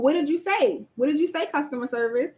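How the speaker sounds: usual speaking voice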